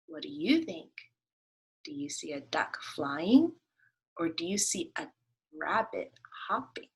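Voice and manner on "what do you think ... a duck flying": ordinary talk, nothing marked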